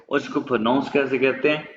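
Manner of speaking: ordinary delivery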